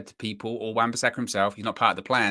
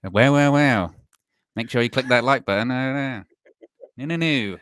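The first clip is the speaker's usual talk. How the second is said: mock close-to-the-mic streamer, louder than usual; Josh; 6 dB louder than usual. Host mimicking his colleague's intrusive interruptions, close-mic impression. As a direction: impersonate him talking right into the mic, inside the listener's head. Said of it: "so close into the microphone".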